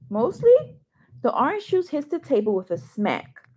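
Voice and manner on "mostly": surprised echo. Acted. Dad surprised at daughter's answer.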